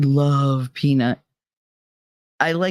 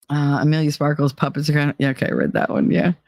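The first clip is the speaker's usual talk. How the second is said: usual speaking voice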